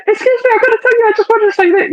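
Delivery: high mock-nervous stranger voice; mock nervous approach. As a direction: mimic a jittery guy rushing a pickup line. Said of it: "he spoke really quickly".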